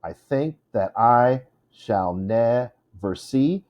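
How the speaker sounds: slow "I THINK that I shall NEver SEE", beat by beat; metered reading. Shown as a demonstration. Teacher counting four feet, slow measured scansion. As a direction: separate the feet with slow, even stresses. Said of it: "it's four feet".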